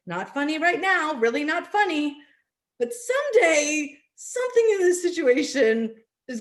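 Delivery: wry dismay. Retold or imitated. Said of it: "you're like".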